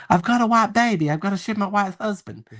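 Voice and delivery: wife walking to her husband, high chirpy mock voice; mock clueless wife. Mocking the wife's oblivious walk.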